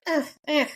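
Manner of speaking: funny grunts. Retold or imitated. Mimicking all the girls at balance training, funny grunts. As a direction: grunt twice as if losing balance. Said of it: "are making sounds like".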